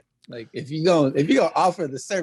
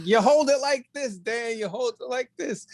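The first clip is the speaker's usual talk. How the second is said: coaching; 7 semitones higher than usual. Retold or imitated. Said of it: "I was like".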